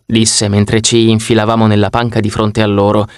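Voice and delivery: plain reading voice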